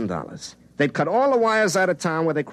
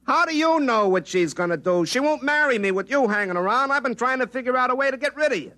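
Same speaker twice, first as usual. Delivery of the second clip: higher, rushing protest at himself; indignant protest; 11 semitones higher than usual. Acted. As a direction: push back, getting faster. Arguing with his future self.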